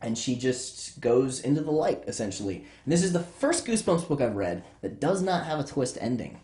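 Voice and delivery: normal talking voice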